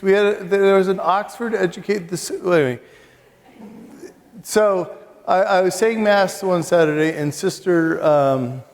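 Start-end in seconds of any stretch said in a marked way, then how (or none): none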